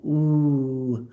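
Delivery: a held oo vowel; long oo. Shown as a demonstration. Teacher demonstrating the English oo sound. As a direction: stretch out oo. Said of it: "the long O O".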